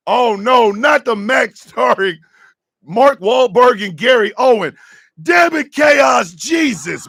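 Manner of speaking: loud, horrified outburst at the news; excited shouting. Real, not acted. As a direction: yell it like you can't believe it. Horrified reaction to casting news.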